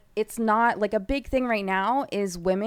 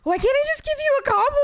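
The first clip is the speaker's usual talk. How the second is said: high, fast mock whine; mimicking friend; faster than usual. A woman mimics her friend's compliment, fast and high-pitched. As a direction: squeak it out fast, teasing a friend. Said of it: "you're like".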